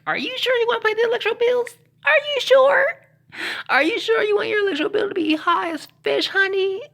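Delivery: squeaky high, loud teasing challenge; high mock scold. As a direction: needle them in a very high voice. High shrill mock-scold about bills.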